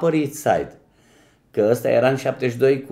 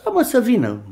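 airy, pitched-up okay; breezy agreement; 11 semitones higher than usual. Retold or imitated. Manager tells his friend on the phone to send him over. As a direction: toss off a carefree yes.

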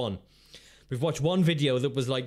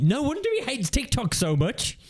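mock outrage; 10 semitones higher than usual. Mock outrage at Mister Grey.

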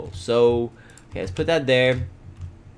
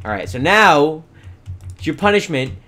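usual speaking voice

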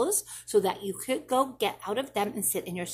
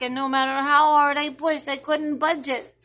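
louder, pushing hard; mild straining; 5 semitones higher, 7 dB louder than usual. Acted. Child acting out pushing the door, louder. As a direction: push the words like a stuck door.

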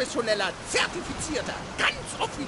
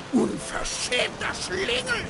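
indignant scolding; 8 semitones higher, slower than usual. Acted. Kobold scolds boy.

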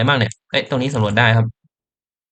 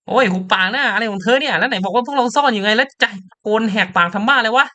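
higher, sharper scolding; angry character; 7 semitones higher than usual. Acted. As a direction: tell them to shut up. (ตัวละครดุเสียงสูงให้หุบปาก)

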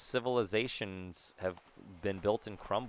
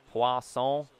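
poisson said the French way, "pwah-sohn"; French accent; 2 semitones higher, 3 dB louder than usual. Shown as a demonstration. Player toys with a fun word, French accent. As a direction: say poisson in French, with a nasal ending. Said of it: "say in a French accent".

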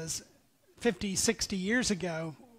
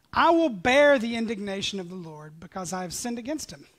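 louder impassioned preaching; preaching at full force; 5 dB louder than usual. Acted. Preacher voicing the prophet's answer, impassioned. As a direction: drive the reply home.

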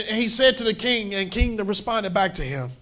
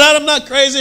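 higher, louder denial; loud protest; 7 semitones higher, 7 dB louder than usual. Acted. Paul loudly denying he is crazy to Festus. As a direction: insist you are not crazy.

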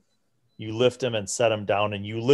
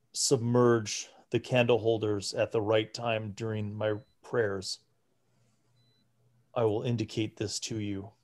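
put-on hushed, softer voice of a frail old priest; 4 dB quieter than usual. Frail priest questions the party about the ritual, hushed.

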